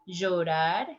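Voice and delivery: llorar as "jorar"; voiced j pronunciation. Shown as a demonstration. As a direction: start llorar on a buzzed zh. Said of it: "Listen the pronunciation".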